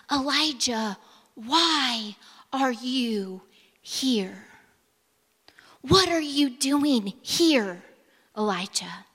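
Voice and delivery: slow, whispered question from God; God's tender, whispered question to Elijah; gentle whisper. Acted. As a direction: coax him softly in a hush. God speaks in the stillness.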